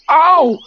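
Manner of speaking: loud, high yelp; cry of pain. A narrator's reading. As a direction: yelp as if yanked hard. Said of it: "Pooh only said".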